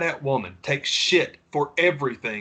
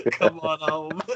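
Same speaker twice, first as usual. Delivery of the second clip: slow, laughing repeat; 3 semitones higher, slower than usual. Retold or imitated. Host laughing as he repeats the catchphrase, slow and amused. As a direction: chuckle through it slowly. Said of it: "repeating the expression laughing".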